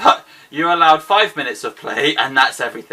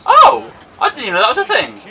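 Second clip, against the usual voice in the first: high, fast cry of surprise; astonished outburst; 8 semitones higher, 6 dB louder than usual. Real, not acted. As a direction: blurt it out in sudden amazement. Discovers an unknown game feature.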